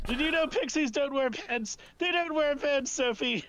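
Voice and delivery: high, wailing blubber; happy sobbing. Acted. Aurora telling Sophie about pixies, blubbering wail. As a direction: wail it in tearful disbelief. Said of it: "happy sobs at this point".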